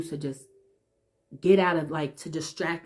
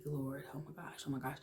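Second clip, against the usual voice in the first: much softer, pleading breath; frightened whisper; 15 dB quieter than usual. Retold or imitated. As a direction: whisper a quick plea to God. Scared child calls on God.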